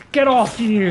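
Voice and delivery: sharp yell at an enemy. Real, not acted. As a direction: shout them off. Yelling at attacking enemies.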